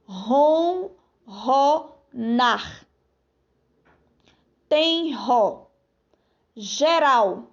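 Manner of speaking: words read slowly with pauses between; slow, careful reading. Shown as a demonstration. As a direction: read each word slowly and clearly. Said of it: "Então vamos ler as palavras?". (leitura lenta e pausada de cada palavra)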